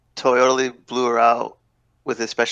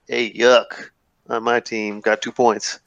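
Ayuk on my team got two points, dragged out slowly and let down; slow disappointed Ayuk; much slower than usual. Shown as a demonstration. Fantasy player sulking about his benched back, said slowly. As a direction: drag "Ayuk" out like a groan of disappointment. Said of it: "where you put the emphasis".

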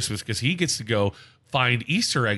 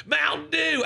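high, imitation heckler holler; mock scream; slower than usual. Fan taunting the Mellow Yellow man, mock scream. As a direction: call it out way up high, no real volume. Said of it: "he starts screaming".

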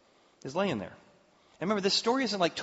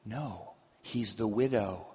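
ordinary talk

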